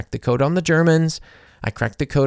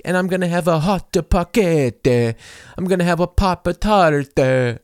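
overpronounce everyday food like a gourmet; mock overpronounced; 6 semitones higher than usual. Trend stitchers mocking the blogger, hammed-up. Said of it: "overpronouncing that".

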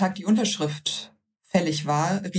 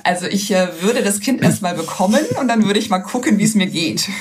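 lachend zitiert; 3 semitones higher, 6 dB louder than usual. Retold or imitated. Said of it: "Und dann hab ich gesagt".

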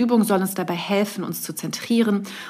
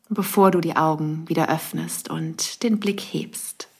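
guided meditation. Acted. Leads a breathing meditation.

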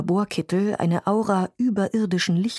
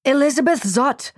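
high, loud, curt retort; snapped back; 7 semitones higher, 5 dB louder than usual. A narrator's reading. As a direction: snap your name back at him. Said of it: "blaffte sie zurück".

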